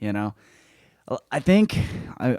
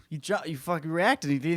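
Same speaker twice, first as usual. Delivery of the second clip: usual speaking voice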